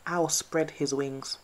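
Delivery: usual speaking voice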